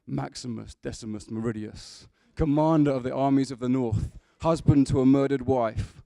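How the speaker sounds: loud, commanding film-hero declaration; Russell Crowe. Acted. Preacher quoting Gladiator to his congregation, in a booming heroic voice. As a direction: boom it out like a wronged general. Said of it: "That's quite Russell Crowe".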